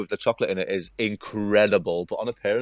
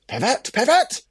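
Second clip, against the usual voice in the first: excited shout; 5 dB louder than usual. Pretend. Chanting the pivot queen nickname.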